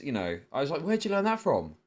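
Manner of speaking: ordinary talk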